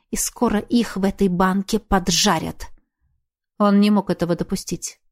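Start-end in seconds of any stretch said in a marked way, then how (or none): none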